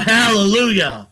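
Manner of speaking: a burst of praise; cried out. Retold or imitated. Soldier crying out to the sky in rapture. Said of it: "and I cried out".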